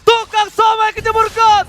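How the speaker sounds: excited shout. Real, not acted. Co-caster reacting to fight.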